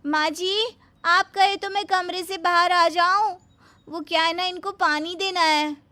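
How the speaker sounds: high, loud, sweetly overeager; sugary bahu voice. Acted. Bahu asking her mother-in-law about cutting potatoes, sugary and high. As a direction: lay the sweetness on thick.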